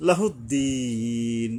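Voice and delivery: slow লাহুদ্দিন with a long-held ee; three-alif elongation. Shown as a demonstration. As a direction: hold the last vowel of লাহুদ্দিন before the n. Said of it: "তিন আলিফ টাইনা". (লাহুদ্দিন তিন আলিফ টেনে)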